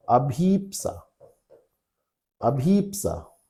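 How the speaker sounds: अभीप्सा with long ई, slowed on the repeat; अभीप्सा drawn out with a long ई; correct form. Shown as a demonstration. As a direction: say अभीप्सा slowly, stretching the ई. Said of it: "ये सही होगा ना".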